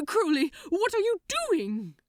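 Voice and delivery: high, loud, frightened plea; an angel terrified for his demon friend. Acted. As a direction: cry out in fear for him. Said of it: "Aziraphale was frightened".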